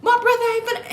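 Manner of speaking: high, strained retelling of his threat; imitating a drunk man's rant; mock angry man. Imitating the drunk brother threatening at the pool.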